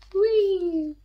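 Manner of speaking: high playful whoop; gleeful whee. Acted. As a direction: let it fly away with joy. Bag flies off, delighted cry.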